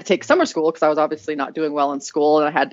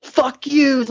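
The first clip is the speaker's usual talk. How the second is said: defiant curse; 8 semitones higher, 3 dB louder than usual. Retold or imitated. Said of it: "I just gave them two fucking, like".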